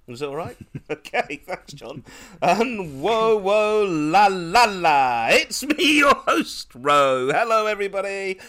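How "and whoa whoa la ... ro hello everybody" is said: high, loud, showy self-introduction; podcast announcer. Acted. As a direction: belt out the host's own entrance. Host introduces herself in announcer style.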